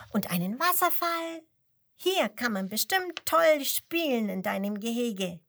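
high, loud, cheerful calling; friendly call. A narrator's reading. Penguin cheerfully praising the lion's enclosure, excited. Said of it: "ruft freundlich".

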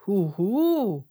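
drawn-out hooting greeting; owl hoot. A narrator's reading. Owl's greeting, acted as a hoot. (Uhu-Ruf, lang gezogen)

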